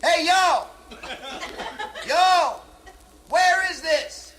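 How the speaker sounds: loud high hollering, last bit slow; hammed-up sailor hollering; calling out. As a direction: shout over the rail to the beach. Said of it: "He says".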